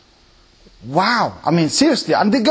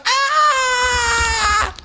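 high mock fangirl squeal; fangirl scream. Preacher mocking fans with a high squeal. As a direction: squeal like a starstruck fan. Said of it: "they're all like".

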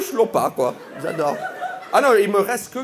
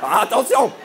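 mock warning; 4 semitones higher, faster than usual. Mock warning to the Alsatian friend.

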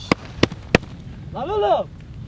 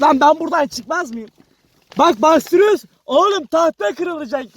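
ordinary talk, nothing marked

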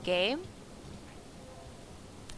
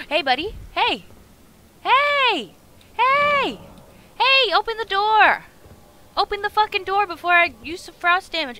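high, loud yelling; shouting at a locked door in a game; angry yelling; 11 semitones higher, 12 dB louder than usual. Acted. Yelling at NPC behind door.

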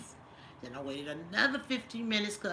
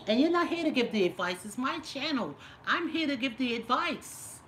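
louder, higher telling off; firm scolding; 5 semitones higher, 6 dB louder than usual. Real, not acted. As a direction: put the troll in his place. Scolding the troll viewer.

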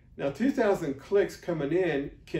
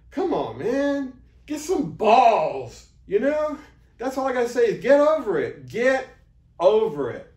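louder, firm scolding push; tough love; 6 semitones higher, 6 dB louder than usual. Real, not acted. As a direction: snap them out of their fear. Scolding scared video makers.

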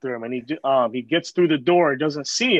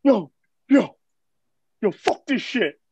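higher mock-exasperated outburst; retold upset anger; 3 semitones higher, 3 dB louder, slower than usual. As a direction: imitate a friend near tears and fed up. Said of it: "borderline fucking panting and crying and pissed off".

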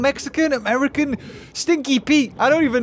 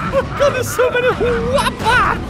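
very high, shouted, giddy panic; frantic excited shrieking; 8 semitones higher than usual. Real, not acted. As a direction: squeal at being hugely outnumbered. Swarmed by many sharks.

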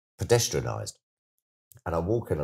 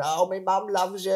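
much higher, lightly voiced fan; gushing fan; 13 semitones higher than usual. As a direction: tell him your mum adores him. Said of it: "Do you know what I mean?".

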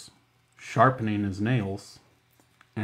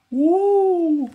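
high excited whoop; sudden high woo of glee; 7 dB louder than usual. Acted. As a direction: squeal as the chair spins. Chair spins Mrs Campbell round.

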